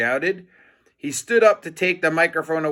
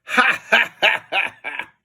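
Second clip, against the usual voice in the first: mocking laugh; 5 semitones higher than usual. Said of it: "laughed Mister Krupp".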